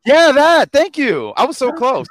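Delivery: delighted yes, that's it; excited relief. Real, not acted. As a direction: blurt it out like you finally remembered. Relieved to recall title.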